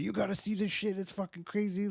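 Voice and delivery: high-pitched, excited insistence; excited urging. Retold or imitated. Patient telling his therapist about a crazy find, excited urging.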